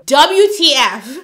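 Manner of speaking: slow, loud WTF then a laugh; disbelief. Real, not acted. Hearing his answer, she spells out WTF loudly. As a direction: spell it out in shock.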